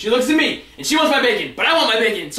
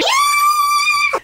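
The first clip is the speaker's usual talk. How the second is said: put-on distant children's cheer; kids screaming. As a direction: shriek like a crowd of excited kids.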